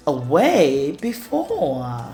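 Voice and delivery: ordinary delivery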